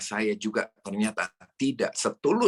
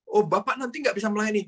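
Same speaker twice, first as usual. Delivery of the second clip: much higher, exaggerated worried voice; mock critic; 11 semitones higher than usual. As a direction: play the fretting critic, high and mocking. Mocking imagined critic's warning.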